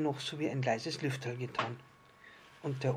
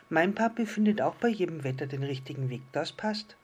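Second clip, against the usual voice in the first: higher, quick little-star voice; pleased little star; 4 semitones higher, 5 dB louder than usual. Acted. Little star approves of Papi to the island fish, higher and quick. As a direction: chirp it as a small storybook star.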